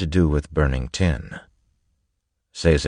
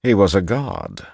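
lightly voiced gentleman making his point, pitched up; lecturing gentleman; 7 semitones higher than usual. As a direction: lift the pitch a little and argue the point. Breeze makes his counterargument.

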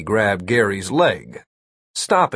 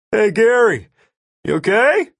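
high, raised call across a distance; 11 semitones higher, 4 dB louder than usual. A narrator's reading. Jeff calling to Gary hanging in the sky, called out. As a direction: holler over to check on him. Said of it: "Jeff called out".

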